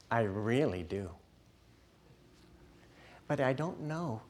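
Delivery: softly guilt-tripping parent voice; a quiet, disappointed parent coaxing a child; whiny parent God. Acted. Demanding parent God lovingly scolding the child, softly whiny. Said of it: "with a voice that sounds like this".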